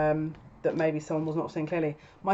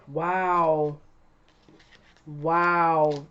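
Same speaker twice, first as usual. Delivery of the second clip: real astonishment. Real, not acted. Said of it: "this has already blown my mind".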